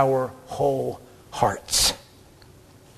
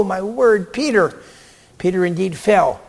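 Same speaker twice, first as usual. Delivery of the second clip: ordinary talk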